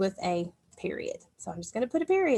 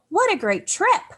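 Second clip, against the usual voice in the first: "What a great trip" said much higher and louder, full of excitement; exclaimed; 10 semitones higher, 6 dB louder than usual. Shown as a demonstration. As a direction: exclaim it like a thrilled kid. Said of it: "is an exclamation or an exclamatory sentence".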